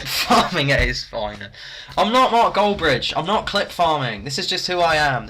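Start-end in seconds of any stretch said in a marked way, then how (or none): 2.0-5.3 high, loud protest; mock indignant. Streamer denying clip farming to viewers, mock indignant. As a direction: deny the charge emphatically.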